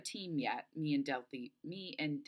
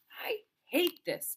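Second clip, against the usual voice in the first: high, drawn-out complaint; frustrated; 4 dB louder, slower than usual. Acted. Delphi fed up with the powerless cottage, frustrated. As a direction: let it out slowly, pitched way up. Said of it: "Delphi says, frustrated".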